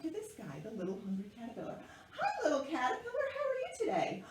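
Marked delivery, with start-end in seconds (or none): 2.1-4.2 very high, quick sing-song hello; puppet greeting. Pretend. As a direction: greet a tiny creature for the children. Greets puppet caterpillar for children.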